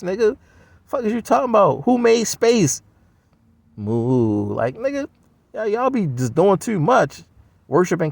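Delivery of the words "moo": low, drawn-out mock moo; cow moo. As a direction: moo back like a dumb cow. Cow answers who made space.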